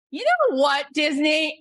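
high, loud mock telling-off; mock indignation. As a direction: push it up high, calling Disney out. Acted indignant reply to a price.

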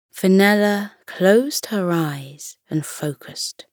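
usual speaking voice

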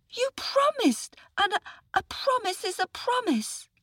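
very high, stammered start; protesting little boy. A narrator's reading. Boy protests to the tiger, high-pitched indignation.

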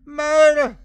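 high, loud desperate scream; high, drawn-out shout of alarm; anguished cry. A narrator's reading. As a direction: scream it out as a horrified mother. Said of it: "Esme cried".

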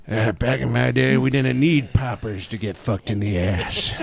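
Clint Eastwood impression. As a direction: grumble like an old Eastwood. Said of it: "Clint Eastwood going like".

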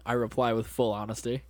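ordinary talk, nothing marked

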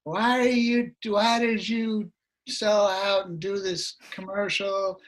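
put-on a light drunk woman, slow; mildly voiced tipsy accuser; a fan scolding the singer for selling out, lightly drunk. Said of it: "this drunk woman accosted me".

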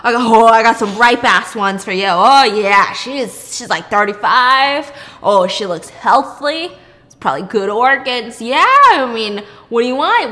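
loud, gleeful salesman voice; excited dealer pitch. Retold or imitated. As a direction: hawk the organs like a market seller. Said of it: "he's so excited".

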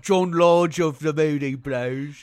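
put-on slow, loud British accent. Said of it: "terrible British accents".

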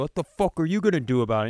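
mock challenge. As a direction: goad him like a cocky jerk. Imagined taunting challenge from creep.

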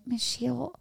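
lightly voiced child admitting hurt, quiet and hesitant; small child. Kid telling teacher Michelle about hurt feelings, lightly voiced shy child.

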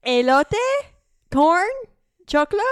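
each word loud, rising into a question; tentative guesses. Retold or imitated. Trying words on confused cooks, loud questioning. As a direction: offer Elote, Corn, Choclo as separate unsure questions. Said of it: "And I'm like".

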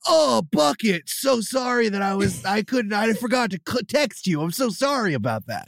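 put-on high, pleading voice of a sorry boyfriend; apologetic Mario Lopez. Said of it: "you would play Mario Lopez".

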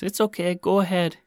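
high-pitched mock woman's voice; high, courteous little voice; polite woman. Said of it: "The woman says".

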